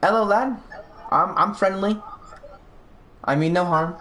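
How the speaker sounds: high-pitched friendly hail; high, placating greeting to a looming creature; calling out. Acted. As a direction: call out high to show you mean no harm. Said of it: "I'll call out to".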